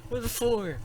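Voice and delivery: a hint of a worried kid; lightly voiced child. Voices the boy David.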